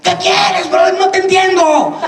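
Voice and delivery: high-pitched shouted retort; mock-confrontational holler; retold yelling back. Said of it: "le contesté igual".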